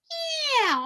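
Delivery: long, high, sliding "Yeah". Shown as a demonstration. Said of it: "your higher voice".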